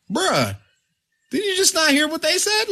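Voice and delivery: high-pitched mock disbelief; retold incredulous protest. Retold protest to his father.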